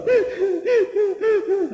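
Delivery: high-pitched mock boo-hoo; put-on childish crying; imitated child's sobbing. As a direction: imitate a little boy sobbing. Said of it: "When my son looked at me crying".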